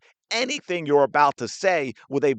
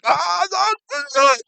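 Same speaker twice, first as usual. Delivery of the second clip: whiny complaining cries; 4 dB louder than usual. Retold or imitated. Mimicking a player complaining to coach.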